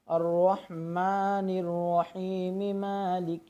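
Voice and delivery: very slow flowing chant without stopping; continuous recitation. Shown as a demonstration. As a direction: recite straight through, melodically. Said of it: "direct ही पढ़ेंगे". (बिना रुके धीमी तिलावत)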